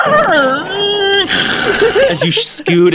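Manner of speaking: breaking into laughter mid-sentence; high laugh through the words. Real, not acted. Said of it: "laughs mid-narration".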